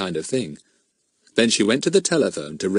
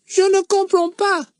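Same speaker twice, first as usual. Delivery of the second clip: put-on silly, much higher boy's voice; 3 dB louder than usual. A narrator's reading. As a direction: pipe it up like a silly schoolboy. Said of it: "in a rather silly, high voice".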